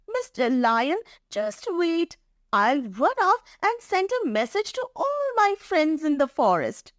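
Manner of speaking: high-pitched mouse voice; eager little mouse. Acted. Mouse telling the lion it will fetch friends, eager. Story mouse calls for patience.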